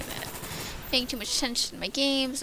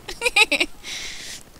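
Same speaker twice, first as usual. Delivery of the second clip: breathy high-pitched giggle; 11 semitones higher, 4 dB louder than usual. Real, not acted. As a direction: burst into a quick giggle. Laughing at own remark.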